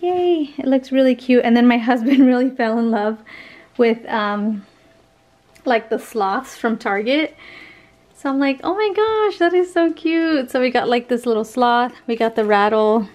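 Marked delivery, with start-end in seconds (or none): 0.0-0.5 squeal of delight. Retold or imitated. Cheering at nursery decor. 8.6-10.5 gushing. Retold or imitated. Gushing over sloths.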